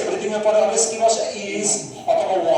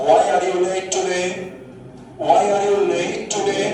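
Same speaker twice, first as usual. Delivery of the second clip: slow, careful model question; teacher asks why late; 3 dB louder, much slower than usual. Shown as a demonstration. Teacher modelling for students, slow and clear. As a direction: spell it out slowly for the class. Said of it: "teacher কি বলছে?".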